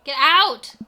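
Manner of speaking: loud angry yell; shouting. Acted. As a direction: yell it out in anger. Amanda yelling at dad.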